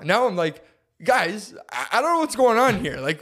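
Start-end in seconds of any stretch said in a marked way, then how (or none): 1.0-3.0 higher, louder retold panic; freaking out. Trapped man pleading with two guys, retold freak-out. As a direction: act out alarmed, high-pitched pleading. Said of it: "So I'm freaking out now".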